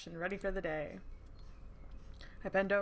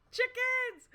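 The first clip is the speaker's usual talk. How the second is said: high, loud delighted shout; excited squeal; 4 dB louder than usual. Real, not acted. Gamer yelling with glee at the chickens, high and loud. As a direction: burst out with glee.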